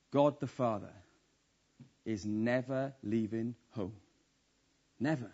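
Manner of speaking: preacher promising God never leaves; hushed reassurance. Real, not acted. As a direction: lean in and reassure them gently. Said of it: "solemn promise, dropped voice".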